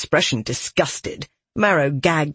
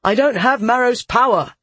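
higher, heated retort; snapping; 8 semitones higher, 4 dB louder than usual. A narrator's reading. Soldier lacking the girl's power, snapping. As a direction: snap back in frustration. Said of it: "he snapped".

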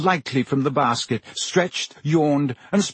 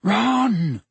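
hissed rebuke; 8 semitones higher than usual. A narrator's reading. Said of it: "hissed Hermione".

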